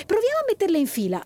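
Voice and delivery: put-on excited little girl, quick and high; eager urging. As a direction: hurry him along with excitement. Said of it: "lo incalzò Giulia".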